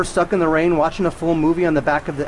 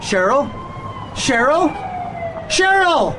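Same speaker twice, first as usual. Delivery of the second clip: rising panic; 12 semitones higher than usual. Acted. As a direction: build from worry to panic. Husband calling, rising panic.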